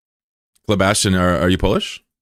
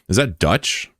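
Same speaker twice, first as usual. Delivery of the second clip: ordinary talk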